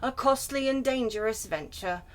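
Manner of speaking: ordinary delivery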